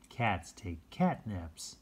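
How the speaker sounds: ordinary delivery, nothing marked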